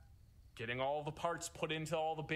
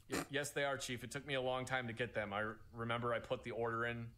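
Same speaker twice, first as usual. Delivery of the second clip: ordinary talk, nothing marked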